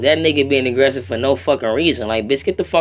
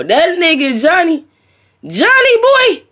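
much higher and louder, slow; excited shout; 13 semitones higher, 7 dB louder, slower than usual. Real, not acted. Vlogger hyping [name], excited high shout. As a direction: shout the name out in hype.

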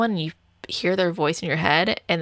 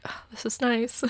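contented sigh of comfort; 4 semitones higher, 6 dB quieter than usual. Retold or imitated. Enjoying his hug.

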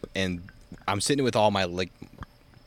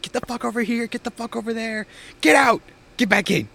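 high, loud shouted orders; drill sergeant yelling; 13 semitones higher, 4 dB louder than usual. Retold or imitated. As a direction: scream the orders at the recruits. Drill sergeant flaming recruits.

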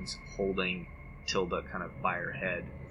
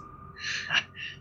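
creature laugh. Acted. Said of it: "a voice in your ear that says".

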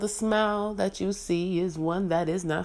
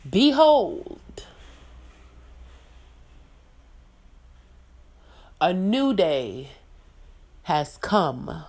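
royal proclamation; 6 dB louder, slower than usual. Pretend. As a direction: proclaim it like a queen. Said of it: "and I declare".

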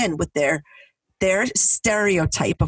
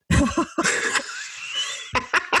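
airy unvoiced laugh at her own joke; breathy laugh; 7 semitones higher, 4 dB quieter than usual. Real, not acted. Laughing to her friend about the joke, airy laugh. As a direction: let a breathless laugh slip out.

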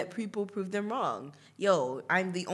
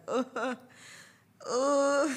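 embarrassed groan; 6 semitones higher than usual. Retold or imitated. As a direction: groan as if squirming with shame. Reliving the embarrassment.

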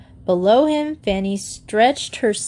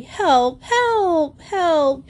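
higher pleading cries; shouting again; 8 semitones higher, much slower than usual. A narrator's reading. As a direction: call out for help once more. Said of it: "he shouted again".

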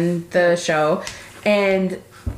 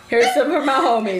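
ordinary delivery

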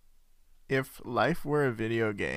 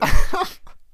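shrieking laugh; 19 dB louder than usual. Real, not acted. Reacting to giant fists idea.

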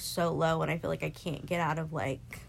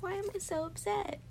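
high, self-pitying plea; high, exasperated question to herself; 11 semitones higher, 6 dB quieter than usual. Retold or imitated. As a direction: wail it at yourself up high. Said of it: "And I was like".

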